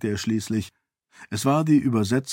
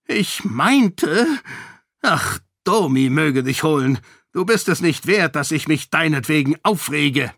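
higher, strained royal impatience; an irritated old king holding back; exasperated king; 4 semitones higher than usual. Acted. King questioning his daughter, exasperated.

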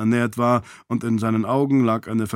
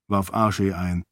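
ordinary delivery

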